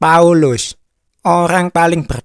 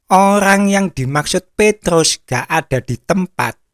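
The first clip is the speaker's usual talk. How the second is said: ordinary talk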